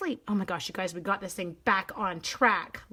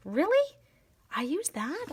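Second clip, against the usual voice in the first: surprised; 8 semitones higher than usual. Real, not acted. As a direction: sound truly surprised. Surprised at own color.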